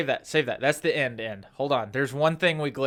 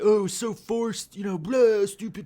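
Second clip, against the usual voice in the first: mocking critics; 5 semitones higher than usual. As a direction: sneer it out like a dismissive troll. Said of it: "They're like".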